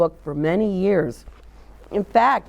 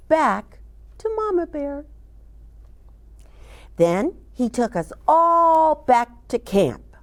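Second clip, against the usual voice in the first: lively storybook reading for little ones; reading to children; 3 semitones higher than usual. A narrator's reading. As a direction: read it like a children's librarian at story time. Reads picture book to children.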